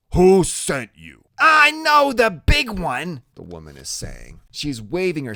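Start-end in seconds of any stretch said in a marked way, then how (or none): 0.1-1.2 suspicious thug voice; tough thug. A narrator's reading. Thug questions the boys. 1.4-3.2 high, slow woman's voice; raspy woman voice. A narrator's reading.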